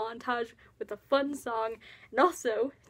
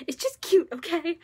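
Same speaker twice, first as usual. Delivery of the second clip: higher and louder, a mildly defensive insist; defensive TJ quote; 5 semitones higher, 6 dB louder than usual. Retold or imitated. TJ brushing off the nickname to Cyrus, playfully insistent. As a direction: toss it off like it's no big deal, playfully pushy. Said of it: "He's like".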